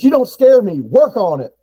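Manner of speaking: high, loud mock sergeant shout; drill sergeant yell. Drill sergeant yelling at recruit.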